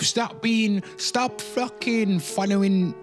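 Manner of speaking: ordinary delivery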